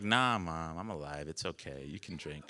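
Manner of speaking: low, soft, soothing tone; quiet reassurance. Retold or imitated. Child to worried mom on shore, low soft reassurance. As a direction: drop low and gently reassure. Reassuring her drunk mother.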